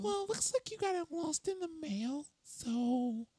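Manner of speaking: high nasal customer service voice; whiny, over-the-top service rep; obnoxious voice. Pretend. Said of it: "trying to find the most obnoxious voice".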